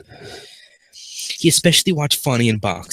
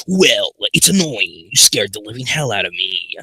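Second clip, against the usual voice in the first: normal talking voice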